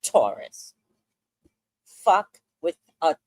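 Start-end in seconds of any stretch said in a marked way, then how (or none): 0.0-0.7 much lower, ominous movie-trailer voice on "Taurus"; deep dramatic voice. Acted. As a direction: drop way down and intone "Taurus" like a warning. Dramatic zodiac sign announcement.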